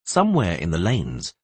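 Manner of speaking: ordinary delivery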